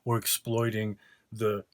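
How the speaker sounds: normal talking voice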